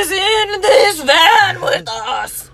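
slow, high, laughing wail; spooky ghost voice. Pretend.

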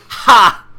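loud, high bark of a laugh; a loud cocky laugh; hammed-up cocky laugh; loud mock ha. Biker's laugh in quote.

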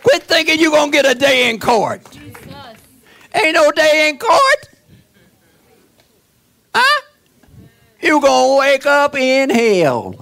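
high, loud preaching, shouting Run; preaching at full force. Acted. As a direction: hammer each line at the congregation. Preacher hammers the congregation.

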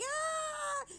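a sudden very high excited shriek; short high-pitched fangirl scream; excited squeal. Retold or imitated. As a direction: squeal like a fangirl who just got the news.

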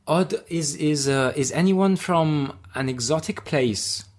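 put-on host asking the comedy crowd, in a light Austrian accent. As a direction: imitate the Austrian host's accent with a hint of hesitation. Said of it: "he said in his Austrian accent".

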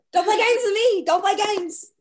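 high, loud, laughing protest; laughing excited. Real, not acted. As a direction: laugh it out, overjoyed. Thrilled, laughing, mock demand.

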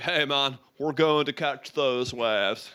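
lightly voiced surfer dude, slow and laid-back; a hint of a California surfer, drawn out; surfer dude accent. Shown as a demonstration. Demonstrating for the player a mild surfer dude voice. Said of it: "so a surfer dude".

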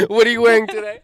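1 mock reporter. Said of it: "are we just reporters".

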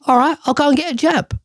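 high, quick voice of a pushover caving in; grudging giving in. Acted. To the voice telling him to get jabbed, high fast grudging give-in. Imagined talk with himself about jabs.

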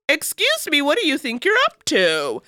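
high-pitched mock challenge; indignant confrontation. Asking a potential killer what he's doing, mock indignant. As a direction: act out an affronted demand, voice lifted high. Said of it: "just state the facts and".

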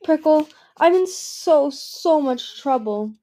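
high, slow, breaking into tears; tearful. Acted. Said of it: "burst into tears".

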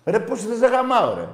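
high, loud outburst; angry shout. Real, not acted. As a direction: yell it in his face. Insulting the caller.

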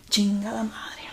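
a voiceless curse at her own slip; whispered curse. Real, not acted. Vlogger tripping over her words, whispered. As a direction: hiss it under your breath.

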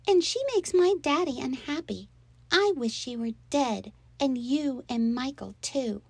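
put-on high, pouting child voice; spiteful little girl. A narrator's reading. Stepsister insulting the other girl's mother, spitefully.

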